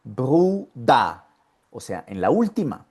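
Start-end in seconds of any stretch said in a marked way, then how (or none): 0.0-1.2 "Bruder" as two distinct beats, "bru-da"; syllable demo. Shown as a demonstration. Teacher locating ER in the second syllable, distinct "bru-da". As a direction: make the second syllable a clear A. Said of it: "está en la segunda sílaba".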